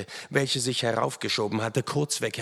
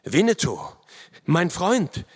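loud, higher cry of alarm; alarmed call; 7 semitones higher, 5 dB louder than usual. A narrator's reading. Narrator to fallen Winnetou, alarmed call. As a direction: call out to a stricken friend. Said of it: "rief ich".

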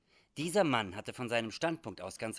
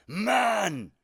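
loud, high warning cry; outraged bellow at an accuser; angry shout; 10 semitones higher, 11 dB louder than usual. Acted. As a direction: shout it at him, long and threatening. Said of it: "rief ihm der Führer zu".

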